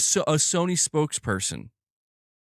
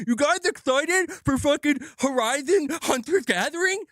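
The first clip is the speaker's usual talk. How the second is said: mocking, high-pitched studio hype voice; mock excited; 9 semitones higher than usual. Mocking Guerrilla hyping Horizon to fans, mock excited. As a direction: put on an exaggerated, higher voice to mock the pitch.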